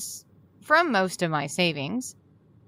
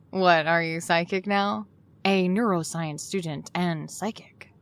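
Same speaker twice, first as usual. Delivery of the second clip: normal talking voice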